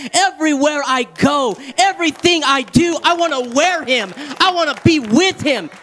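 full-voiced, insistent church exhortation; fervent preaching. Real, not acted. Preacher urging the congregation toward immediate healing, fervent. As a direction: preach with rising urgency and drive.

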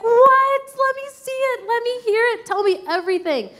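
very high, eager what; bursting to hear everything; God eagerly asking to hear it all, very high; eager interest. Retold or imitated. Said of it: "He's like".

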